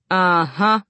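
lightly voiced big man; a slightly booming acknowledgement; loud manager. A narrator's reading. Manager hearing a child's confession, booming. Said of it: "still talking loudly".

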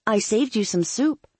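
Claire. A narrator's reading.

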